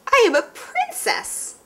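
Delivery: high, slow, dainty princess. Acted. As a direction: sing it out as a princess. Mom plays wet princess.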